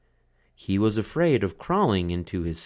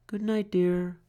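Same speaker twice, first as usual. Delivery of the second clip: tuck him in gently; tender mother; 11 semitones higher than usual. A narrator's reading. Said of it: "she said".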